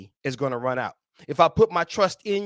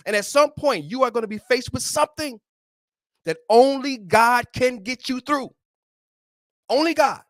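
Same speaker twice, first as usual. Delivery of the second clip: higher and louder, building to Only God; preaching at full force; 5 semitones higher, 4 dB louder than usual. Acted. Preacher driving home his point, rising and louder. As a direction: rise to the peak of the sermon.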